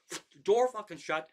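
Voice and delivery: ordinary talk, nothing marked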